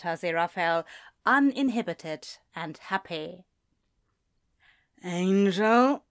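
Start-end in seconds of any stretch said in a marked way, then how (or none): 5.0-6.0 a hint of a sleepy drunk's query; drunken demon. Drunk demon blearily recognizing the angel. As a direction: look up blearily and ask.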